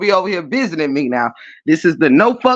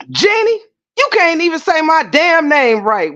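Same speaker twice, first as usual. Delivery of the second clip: higher, indignant Waba comeback; mock Waba; 8 semitones higher than usual. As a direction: throw it back like an offended man. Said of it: "Waba said".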